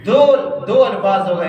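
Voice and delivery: ordinary talk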